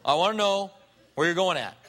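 a pushy "I wanna know", half laughing; mock demanding. Peter insists to Jesus on an answer, half-laughing and pushy. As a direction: press for an answer like a nosy friend.

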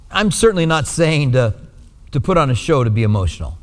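ordinary talk, nothing marked

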